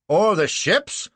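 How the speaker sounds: bawled. Acted. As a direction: fling the retort across the court. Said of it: "bawls Clitheroe".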